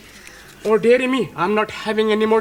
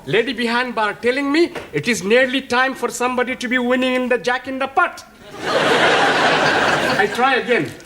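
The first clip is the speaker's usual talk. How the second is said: put-on chatty Indian-English accent, a little laugh at the end; hopeful Indian accent, trying his luck.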